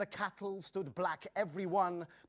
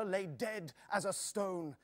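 usual speaking voice

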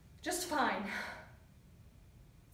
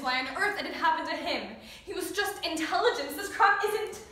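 climbing louder and higher in disbelief; anguished protest; 5 semitones higher, 10 dB louder than usual. Acted. Grieving child unable to accept the death, anguished protest. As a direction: build to a loud, high cry of injustice.

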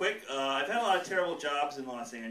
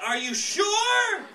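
mock order taker; 7 semitones higher, 7 dB louder than usual. Clerk taking a cake order by phone, doubtful. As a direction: read back the order, then balk. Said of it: "if I'm taking that order on the phone".

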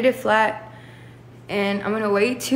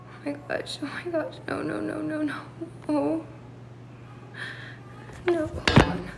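small, higher, distressed voice; quiet dismay; 3 semitones higher, 7 dB quieter than usual. Real, not acted. Alone reacting with no, no, no, hushed distress. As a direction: softly repeat it in shocked disbelief. Dismayed at what she is seeing.

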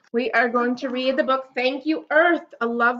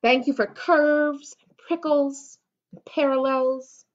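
storybook read-aloud; reading to children; 4 dB louder, slower than usual. Acted. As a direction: read it slowly to a little child. Reads picture book aloud.

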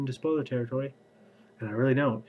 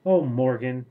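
wailed; 8 dB louder, slower than usual. Acted. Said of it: "Tristan wailed".

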